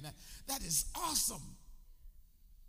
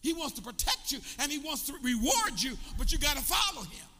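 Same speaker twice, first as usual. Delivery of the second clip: high and loud, shouting the call to follow; full-force preaching; 8 semitones higher, 9 dB louder than usual. Acted. Preacher to congregation, shouting that they must follow. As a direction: drive it home to the crowd. Preacher driving point home.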